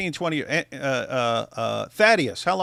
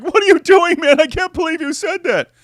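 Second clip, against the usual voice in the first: higher and louder, hammed-up outrage; pretend-offended shriek, pitched up; mock outrage; 12 semitones higher, 8 dB louder than usual. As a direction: play a fake scandalized whine, voice up high. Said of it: "But imagine me coming".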